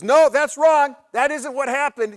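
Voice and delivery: high, loud outburst butting in; mock yelling. Uncle butting in on his wife's chat, yelling. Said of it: "He starts yelling at her".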